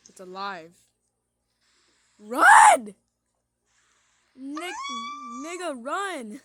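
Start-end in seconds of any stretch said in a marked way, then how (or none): 2.2-6.4 loud, high yell to flee; panicked shout. Real, not acted. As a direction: scream at them to run. Monster is alive.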